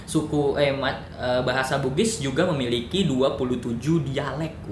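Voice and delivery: ordinary talk, nothing marked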